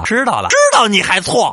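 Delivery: mock mother scolding high and loud; angry parent. Parent scolding child in skit.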